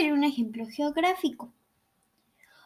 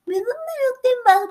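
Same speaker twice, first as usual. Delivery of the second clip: mocking customer; 10 semitones higher, 4 dB louder than usual. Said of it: "y me dice".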